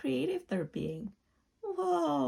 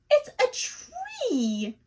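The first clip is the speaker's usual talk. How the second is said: amazed; 12 semitones higher, 6 dB louder, slower than usual. Acted. Opening a door, amazed.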